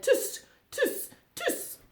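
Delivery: high, cheeky monkey tutting; squeaky little mocking tsks; mimicking monkeys. A narrator's reading. Monkeys copying the angry peddler, teasing tuts. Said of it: "shook both of their hands back at him".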